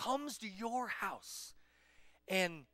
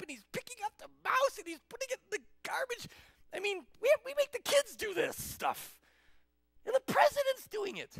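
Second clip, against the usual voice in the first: high-pitched, frantic outrage; hammed-up hysterical disbelief; freaking out; 6 dB louder than usual. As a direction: panic loudly, pitch way up. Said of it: "you're, like, freaking out".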